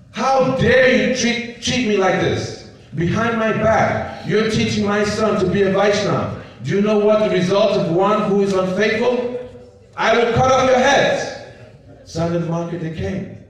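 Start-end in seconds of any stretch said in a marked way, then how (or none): 0.1-11.2 a demon king berating his son's teachers; furious threat. Acted. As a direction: rage at them and threaten death.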